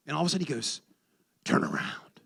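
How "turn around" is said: whispered dove command; dove's voice. Retold or imitated. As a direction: whisper it as the dove's eerie call. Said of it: "that's how the dove talked".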